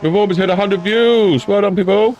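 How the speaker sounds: high, bright cheer; excited. Real, not acted. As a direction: cheer the milestone. Celebrating view count milestone.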